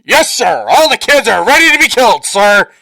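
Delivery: loud, high, fast military shout; hammed-up barracks yell; mock soldier. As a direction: parody an eager soldier reporting. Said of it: "the soldier's like".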